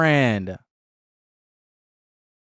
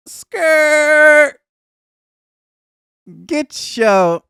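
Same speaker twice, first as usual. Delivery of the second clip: shrill shrieked skirt, much higher and louder; shrieking Jordan; 14 semitones higher, 7 dB louder, much slower than usual. Retold or imitated. Jordan rejecting Amir's advances, a loud shrieking squeal. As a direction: squeal it out like a startled diva. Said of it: "but Jordan was like".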